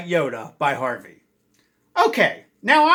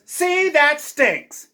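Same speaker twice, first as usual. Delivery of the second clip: quoting Harvey; 9 semitones higher, much slower than usual. Retold or imitated. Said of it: "And he also says".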